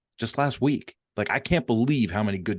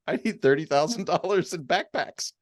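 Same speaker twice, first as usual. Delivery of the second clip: higher-pitched imitation of a sheepish request; sheepish self-mockery; 8 semitones higher than usual. Man retelling his small ask on a big sponsor call, sheepish. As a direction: laugh at yourself while replaying your tiny request. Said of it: "I felt like a moron".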